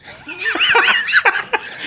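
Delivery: high, loud burst of laughter; laughing. Real, not acted. Man cracking up at his own guilty rabbit story, laughing.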